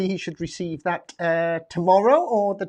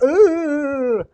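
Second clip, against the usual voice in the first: breathless ooh; 7 semitones higher, 7 dB louder than usual. Real, not acted. Puffing after running upstairs.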